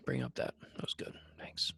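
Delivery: very quiet, nearly whispered mock hurt; playfully wounded, voice sunk to almost nothing; mock tearful. Fan reminded of his team choking, mock tearful murmur. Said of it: "I'm gonna cry".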